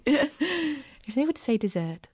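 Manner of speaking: slow, lightly posh "dessert"; mock posh voice. Shown as a demonstration. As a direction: put on a hint of posh voice, slow. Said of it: "I sound like I've got my posh voice on".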